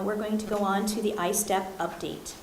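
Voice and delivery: normal talking voice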